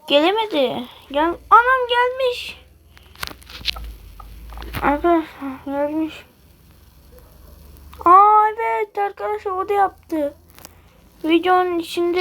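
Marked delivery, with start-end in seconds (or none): 1.5-2.6 much higher, slow amazed cry; surprised exclamation. Real, not acted. Surprised shout at game. 8.0-10.3 higher, louder amazed aa; surprised. Real, not acted. Surprised at game event.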